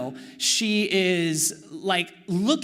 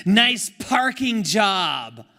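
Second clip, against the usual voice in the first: put-on snide drunk sneer; 7 dB louder, much slower than usual. Drunk lady sneers at parking.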